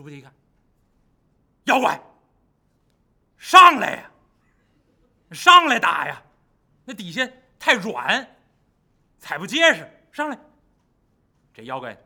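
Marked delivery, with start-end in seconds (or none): 1.6-10.4 loud, high goading shout; taunting call. Acted. Bajie on the bank goading the monster up, loud taunt. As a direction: lure him out with a shout.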